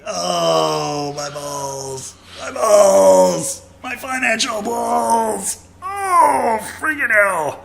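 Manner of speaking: hammed-up high whining cry, stretched out; mock wail. Friend mocks his pain over the cab fare in a hammed-up wail. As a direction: wail like your wallet was kicked.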